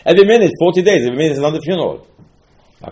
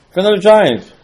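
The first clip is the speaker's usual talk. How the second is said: mocking echo; 8 semitones higher, 7 dB louder than usual. Mocking the spies' fear.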